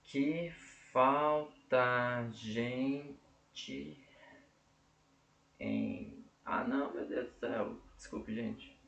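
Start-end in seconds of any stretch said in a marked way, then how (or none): 0.0-4.0 muito lento, sílaba por sílaba; escansão silábica. Shown as a demonstration. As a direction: separe cada sílaba devagar. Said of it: "contei errado aqui". (escandindo o verso devagar)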